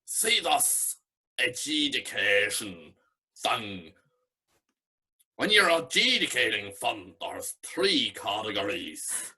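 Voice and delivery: put-on slow drunken Belfast drawl; imitating a drunk Belfast man, lightly; a drunk Belfast judge explaining his scoring, Belfast accent. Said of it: "butchering this accent".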